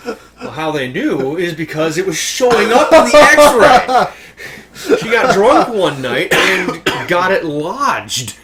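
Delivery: laughing through the punchline, slow; can barely get the words out for laughing. Real, not acted. Telling friends the punchline while laughing helplessly. Punchline of the soda bottle story.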